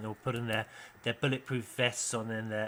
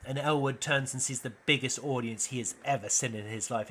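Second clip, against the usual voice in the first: normal talking voice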